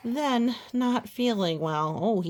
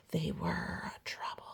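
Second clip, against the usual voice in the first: whispered aside; 13 semitones lower, 8 dB quieter than usual. Real, not acted. Aside about buttons.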